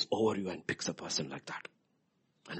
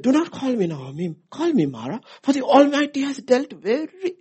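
1 angry protest; 11 dB louder than usual. Acted. Naomi's protest voiced loudly.

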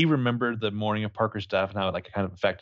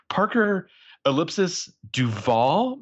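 ordinary talk

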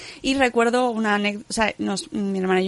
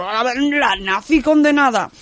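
dramatic title voice; 3 semitones higher, 5 dB louder, slower than usual. Pretend. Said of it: "Porque es como".

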